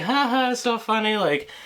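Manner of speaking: high, squeaky mock of a laughing woman. The laughing neighbour shrugging off the kid, high mocking voice. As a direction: ham up her giggling dismissal. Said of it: "like, laughing about it".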